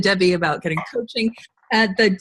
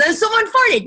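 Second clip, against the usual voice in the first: mock shocked; 13 semitones higher than usual. Everyone reacting to a fart.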